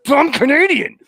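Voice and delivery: high, exasperated imitation of annoyed fans; mock irritated. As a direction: spit it out as a fed-up fan, hammed up. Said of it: "They'd be so irritated".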